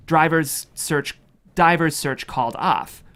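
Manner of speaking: ordinary talk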